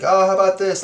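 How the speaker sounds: lightly voiced client suggestion; a hint of a breezy client; mock client idea; client quote. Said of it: "exasperated when the client says".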